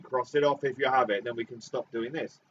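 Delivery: ordinary talk, nothing marked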